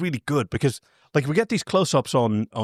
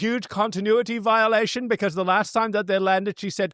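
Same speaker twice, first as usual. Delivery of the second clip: smug nerd nitpicking, pitched up; neckbeard voice; 5 semitones higher than usual. Pretend. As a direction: whine it like a smug know-it-all. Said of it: "How do you like my neckbeard voice?".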